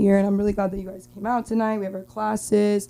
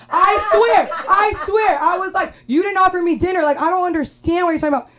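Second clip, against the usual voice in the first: indignant; 9 semitones higher, 5 dB louder than usual. Retold or imitated. Indignant retort to date.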